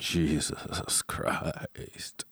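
whispered under breath; a low unvoiced whisper. Real, not acted. Whispered reaction to the cursing.

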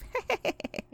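breathless high giggle to himself; a secret trickster snicker; sly chuckle. A narrator's reading. Anansi chuckles to himself over his plan, sly giggle. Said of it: "And Nancy laughed to himself".

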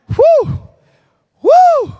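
high, loud exultant whoos; joyful shout. Real, not acted. Preacher whooping to the congregation over God's grace. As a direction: let it burst out in praise.

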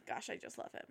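very soft, unvoiced gush on a sigh; swooning sigh. Real, not acted. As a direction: sigh it out, almost whispered, lovestruck. Swooning over a favorite actor.

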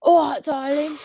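exaggerated East London impression of an actor; bad boy East London accent. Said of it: "like, bad boy East London accent".

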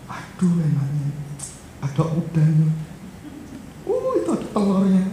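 mock-envious child admiring food; imitated admiration. Retelling classmates admiring a lunchbox.

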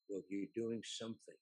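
imitating a low, hushed aside; quiet, personal. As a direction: slip it in quietly. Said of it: "quietly and personally".

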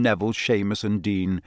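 usual speaking voice

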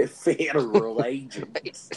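slow echo through laughter; laughing. Real, not acted. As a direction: repeat it while cracking up, drawn out. Cracks up echoing the other speaker.

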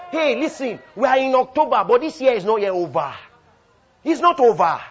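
loud, high rallying cry; full-force preaching. Real, not acted. Preacher telling the church the year isn't over, full-force preaching. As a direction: shout hope to the whole hall. Rallies the congregation.

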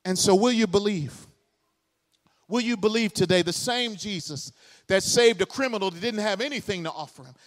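ordinary delivery

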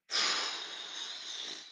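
long, quiet, exasperated sigh; impatient sigh. Retold or imitated. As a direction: huff out your impatience. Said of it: "a guy's there with his bag going".

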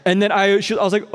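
ordinary delivery

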